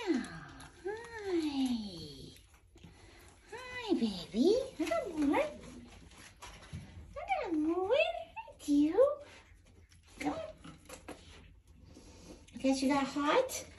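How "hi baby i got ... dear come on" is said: high slow coo. Real, not acted. Greeting pet in baby talk.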